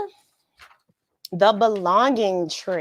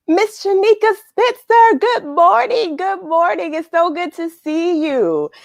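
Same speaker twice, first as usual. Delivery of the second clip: much higher and louder, gushing welcome; excited greeting; 9 semitones higher, 4 dB louder than usual. Real, not acted. Host welcoming a nature-loving friend, high and gushing. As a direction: burst out with delight at her name. Greeting a viewer joining live.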